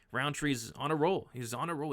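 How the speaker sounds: usual speaking voice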